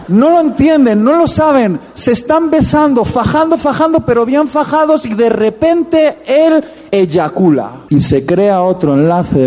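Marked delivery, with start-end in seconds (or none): 0.1-7.7 high, rising comic excitement; much higher building comic intensity; excited buildup. Real, not acted. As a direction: pile it on for the punchline. Preacher builds the scandal climax.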